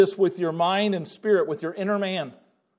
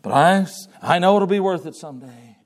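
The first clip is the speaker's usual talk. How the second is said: glum believer; 4 semitones lower than usual. Pretend. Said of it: "It's not".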